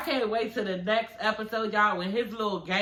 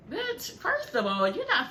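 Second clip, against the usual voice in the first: incredulous scolding; 9 semitones higher, slower than usual. Real, not acted. Scolding the guy's cheesy line.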